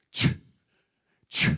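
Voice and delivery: Ch spoken with a strong puff of breath; aspirated ch. Shown as a demonstration. Teacher demonstrating an aspirated Korean consonant with a breathy Ch. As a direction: push air hard through each Ch, almost spitting. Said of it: "Aspirated means that you're putting out more breath".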